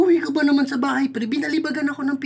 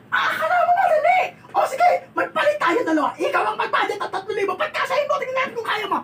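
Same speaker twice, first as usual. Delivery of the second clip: shouting; 8 semitones higher than usual. Acted. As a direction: scream at him in anger. Shouting at gambling husband.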